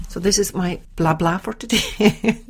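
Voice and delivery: self-mocking. Real, not acted. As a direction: drawl it out, laughing at your own preaching.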